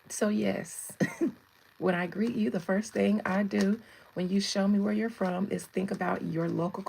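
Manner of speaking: normal talking voice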